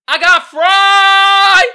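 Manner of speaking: high shout, last word stretched long; hyped, drawn-out holler; shouted reveal. Pretend. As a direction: belt it out like a big announcement. Revealing the next meme.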